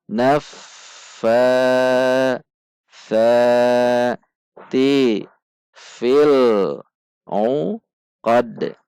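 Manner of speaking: slow chanted tajwid recitation with long held vowels; measured Quranic chant. Shown as a demonstration. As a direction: recite it slowly, stretching the long sounds. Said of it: "Mari perhatikan panjang dan pendek bacaan".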